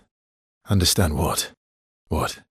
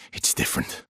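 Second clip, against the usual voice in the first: softer, unvoiced echo to herself; whispered repeat; 6 dB quieter than usual. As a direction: let it fall to a whisper.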